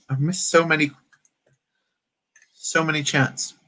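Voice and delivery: ordinary talk, nothing marked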